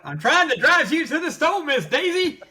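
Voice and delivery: high put-on chauffeur voice; Driving Miss Daisy chauffeur. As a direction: play the old chauffeur. Quoting Driving Miss Daisy line.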